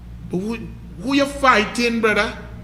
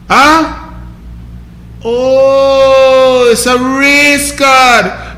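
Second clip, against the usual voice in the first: very slow, drawn-out sarcastic repeat; sarcastic mock; much slower than usual. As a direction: stretch it out to mock him. Mocking the race card defence.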